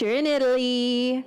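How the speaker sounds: normal talking voice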